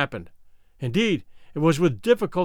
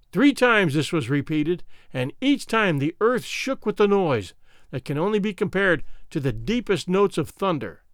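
ordinary delivery

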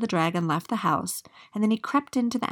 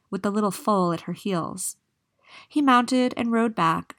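usual speaking voice

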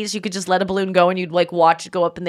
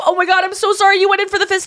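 flustered rush; 11 semitones higher, faster than usual. Retold or imitated. Flustered apology while hugging.

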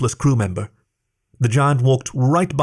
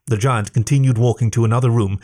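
usual speaking voice

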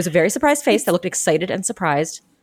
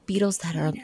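whisper; 6 dB quieter than usual. Real, not acted.

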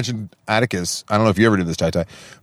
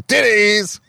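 "Titties" exclaimed high, like the clip; Trump; 11 semitones higher than usual. Retold or imitated. Showing how the cut-off clip sounds, high exclaimed "Titties". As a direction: blurt "Titties" high, opening with a hard T. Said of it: "it just sounds like he's saying".

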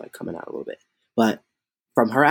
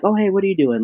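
casual mom; 7 semitones higher, faster than usual. Retold or imitated. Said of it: "she's just like".

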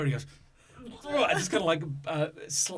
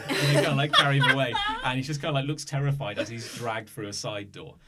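ordinary talk

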